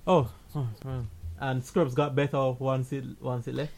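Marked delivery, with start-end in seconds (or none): none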